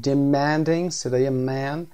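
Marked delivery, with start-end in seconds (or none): 0.0-0.9 Demanding stressed on the second syllable, de-MAN-ding, louder; word stress demo. Shown as a demonstration. Teacher modeling Demanding's stress for students, loud. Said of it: "استرس لبل رو هم ببینیم".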